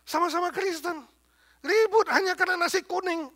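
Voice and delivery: high, loud scoffing outsider voice; imagined outsider mocking quarreling Indonesian Christians; mocking onlooker. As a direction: sneer like a bystander laughing at them. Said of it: "Jangan sampai orang bilang".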